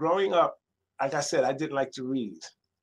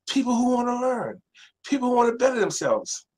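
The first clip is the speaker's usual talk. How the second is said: higher, firm motherly lesson; mother's firm answer; 10 semitones higher than usual. Retold or imitated. Mother tells her son who goes to libraries, firm and higher. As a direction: set him straight like a wise mom.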